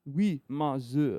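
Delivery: halting English in a French accent. Shown as a demonstration. Trying the host's request, French accent. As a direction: put on a careful French accent. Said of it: "Speak American with a French accent".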